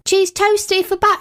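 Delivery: high-pitched café announcement; calling out order. Acted. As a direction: sing out the order like a barista.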